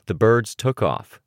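usual speaking voice

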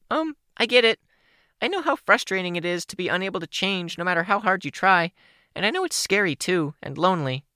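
put-on high, bubbly teen girl voice; bright young girl voice, a little shy; Kenzie's voice. Kenzie sympathizes.

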